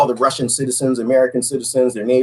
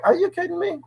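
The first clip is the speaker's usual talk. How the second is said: incredulous; 4 dB quieter than usual. Retold or imitated. Retells disbelief at the picture.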